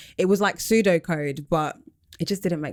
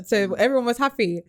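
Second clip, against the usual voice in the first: ordinary talk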